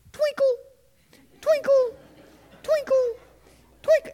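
high jingling cutlery sounds; mock silverware jingle. Boy throws silverware down angrily, high jingling noises. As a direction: toss each fork and spoon down, voiced high.

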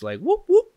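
high, loud whoop calls; imitating guys whooping; mock party whooping. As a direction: holler like rowdy guys passing by. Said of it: "saying nonsense".

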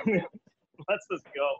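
ordinary delivery

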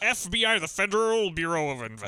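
imitated stilted American accent; bad American accent. Actor introduces himself as FBI leader, bad American accent. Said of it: "his American accent".

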